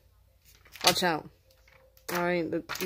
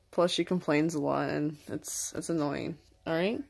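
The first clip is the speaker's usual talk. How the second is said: usual speaking voice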